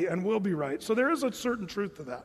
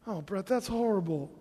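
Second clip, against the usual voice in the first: ordinary delivery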